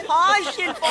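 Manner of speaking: lightly mocking drawn-out greeting; mimicked friend's greeting. Retelling how a friend greeted the girl, mock greeting. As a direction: mimic a friend's eager hello. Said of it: "அப்புறம் ஒருத்தன் எந்திரிச்சு".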